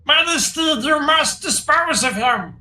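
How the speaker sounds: high, slow pleading kobold; whiny kobold begging the king; squeaky kobold voice. Acted. Sir Dim pleads with king.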